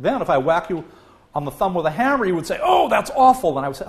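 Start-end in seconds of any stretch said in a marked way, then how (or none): 2.6-3.6 high, loud mock cry of pain. Patient whose thumb was just whacked, high loud mock pain cry. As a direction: wince it out, hammed-up.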